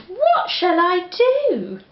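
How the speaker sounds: put-on high, fretful old lady lament; old lady at her wits' end; old lady crying out. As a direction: wail it as a flustered old lady. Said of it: "The little old lady cried".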